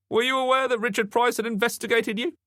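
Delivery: high-pitched detective interrogating; questioning detective. A narrator's reading. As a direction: press the witness in a high voice.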